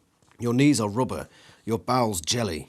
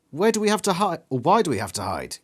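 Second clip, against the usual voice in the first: demanding; 7 semitones higher, faster than usual. Acted. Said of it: "you demand".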